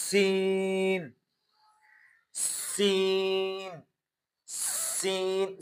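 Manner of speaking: سین with a long hissing s, drawn out slowly; whistling seen. Shown as a demonstration. Teacher showing the whistling sound of سین. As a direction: stretch the hiss in سین. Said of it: "سیٹی کی آواز".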